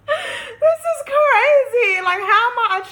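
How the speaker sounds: high, loud, slow crying laugh; can't get it out for laughing; laughter breaking into near tears; laughing so hard it cracks. Real, not acted.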